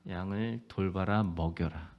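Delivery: low, soft, slow charge; gentle command. Acted. Jesus gently commissioning Peter, soft and slow. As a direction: tell him tenderly to feed the sheep.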